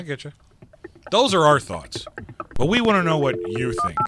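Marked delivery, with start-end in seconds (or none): 1.1-4.0 loud, punchy host announcer delivery; podcast sign-off. Acted. Host asks listeners to write in, in an announcer's sign-off. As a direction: project it with emphatic pauses.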